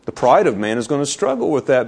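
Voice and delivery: ordinary talk, nothing marked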